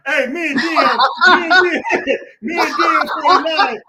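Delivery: loud, high and laughing; hyped, laughing call-out to a viewer; laughing shout. Real, not acted.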